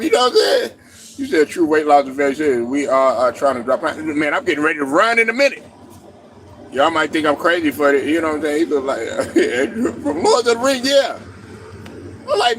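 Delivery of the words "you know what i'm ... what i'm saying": normal talking voice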